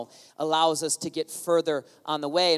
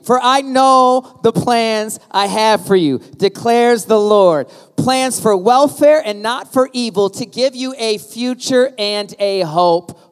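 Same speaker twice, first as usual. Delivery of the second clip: louder, slower, rallying the crowd; getting louder; 3 semitones higher, 9 dB louder, slower than usual. Real, not acted. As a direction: push it out so everyone joins in. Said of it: "Come on, I can't hear you".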